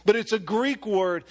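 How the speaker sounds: normal talking voice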